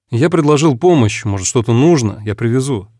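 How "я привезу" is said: plain reading voice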